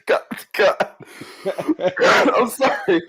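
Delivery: helpless laughter breaking up the words; real laughing. Real, not acted. Host cracking up at his old mentor's story, talking through laughter. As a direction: apologize through a fit of giggles. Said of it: "I'm laughing 'cause it's".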